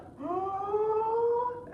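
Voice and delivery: huge, high, drawn-in gasp; big inhale. Acted. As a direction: suck in air like a dragon. Dragon inhales deeply.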